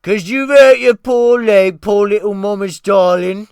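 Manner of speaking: mock him like a crybaby; sneering mockery. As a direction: cook mocking the injured boy in a high, loud sneer. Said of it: "with a renewed rage".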